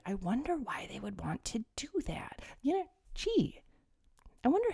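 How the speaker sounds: feigned-innocent whispered wondering; sarcastic whisper. Acted. Vlogger sarcastically wondering why the government tracks vaccinations, whispered. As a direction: whisper it as if the answer weren't obvious.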